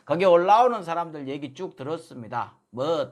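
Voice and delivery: ordinary delivery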